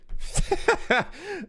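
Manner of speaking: high-pitched giggling laugh; high giggle. Real, not acted. Laughing at his own teeth.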